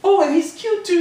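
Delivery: put-on high, teasing girl voice; high-voiced schoolgirl needling; Becky. A narrator's reading. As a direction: pitch it right up, gushing mockingly. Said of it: "she said".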